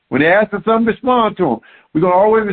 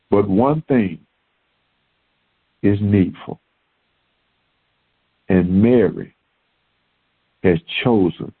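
solemn preaching; 11 semitones lower than usual. Acted. Preacher closes the sermon to the congregation, low and hushed. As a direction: bring it down to a hushed, reverent close.

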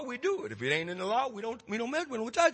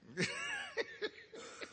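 a real laugh that breaks up high. Real, not acted. Laughs at the old women.

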